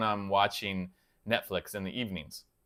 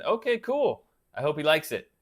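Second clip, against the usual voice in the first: ordinary delivery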